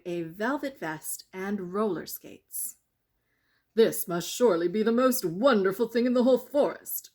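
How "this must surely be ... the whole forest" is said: loud, fast, thrilled outburst; excited cry. A narrator's reading. Prince marvels at a lion in the forest, loud and thrilled. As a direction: shout it out in delight. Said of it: "cried Prince Musclebaum".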